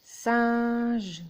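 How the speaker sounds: "Singe" stretched loud, its final e sounded; "Singe" drawn out as sin-ge; accented syllables. Shown as a demonstration. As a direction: lean hard and long on "Singe" to show two syllables. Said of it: "on accentue un peu".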